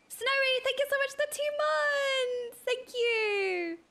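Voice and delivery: high, bright burst of gratitude; excited thanks. Real, not acted. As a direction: gush thanks to a viewer. Thanks a subscriber.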